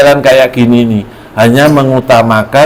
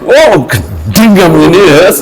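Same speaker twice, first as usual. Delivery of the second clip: mild furious wife; 4 semitones higher than usual. Acted. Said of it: "istrinya tambah ngamuk".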